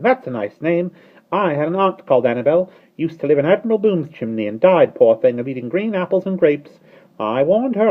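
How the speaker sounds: gossiping starling. Acted.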